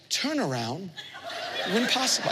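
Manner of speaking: hushed, gentle navigation voice; soft GPS voice. Retold or imitated. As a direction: speak it softly like a kind satnav. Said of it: "she goes".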